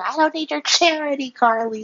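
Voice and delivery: haughty mock Harmony; Harmony voiced proud. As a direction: toss it off high and haughty. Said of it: "She's like".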